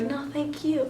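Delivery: soft, modest little reply; restrained thanks. Retold or imitated. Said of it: "I didn't say it like that".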